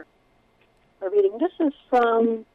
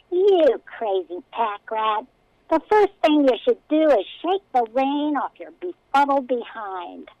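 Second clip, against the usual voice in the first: slow, higher whiny rat voice; whiny king voice; 4 semitones higher, 4 dB louder than usual. Acted. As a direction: scold like a pompous little king. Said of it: "such a spine-grating voice".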